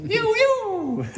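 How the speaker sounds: high laughing yoo-yoo call; high playful hoot. Real, not acted. Calling out yoo-yoo.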